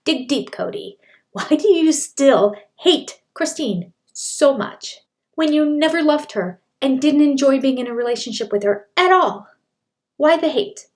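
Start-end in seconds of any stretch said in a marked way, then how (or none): 1.3-10.9 louder, higher rhetorical questions pressed hard; pushing for an answer that never comes; exasperated demand. Real, not acted. Host challenging Cody on hating Christine, exasperated and louder.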